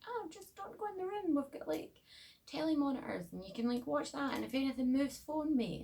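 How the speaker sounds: very high, far-off put-on lady voice; sing-song high voice of a strange homeowner; creepy cuckoo woman. Mocking the odd homeowner to the babysitter, very high and put-on. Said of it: "she's like".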